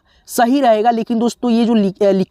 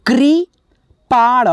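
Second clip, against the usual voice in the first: slow demo; 3 semitones higher, much slower than usual. Shown as a demonstration. Teacher reading the word for students, slow and drawn out. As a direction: sound out कृपाण syllable by syllable. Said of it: "यहाँ पे देखिए लिखा है".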